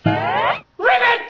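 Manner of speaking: high, long drawn-out croak; frog croak. Acted. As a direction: stretch a high "Ribbit!" hopefully. Frog asks for kiss.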